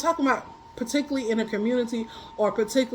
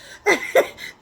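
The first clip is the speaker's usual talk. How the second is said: a high breathless real laugh; cracking up breathlessly at herself; 6 semitones higher, 3 dB louder than usual. Real, not acted. Laughing at herself while telling her viewers about it. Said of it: "I started laughing".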